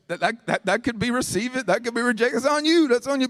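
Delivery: high-pitched retold protest; defensive retort. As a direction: push back, voice climbing as you go. Retells his protest to wife.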